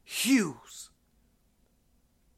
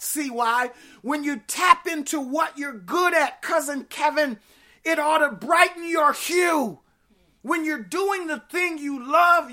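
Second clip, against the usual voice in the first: raised, impassioned preacher's voice; rousing preaching; 5 semitones higher than usual. Real, not acted. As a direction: drive it up to each payoff line, high and emphatic. Preaches at full force.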